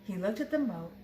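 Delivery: ordinary delivery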